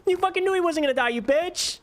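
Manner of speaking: high fast outraged accusation; outraged yelling. Real, not acted. Gamer blaming the game for the boss, outraged high yell. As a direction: rant in shrill outrage.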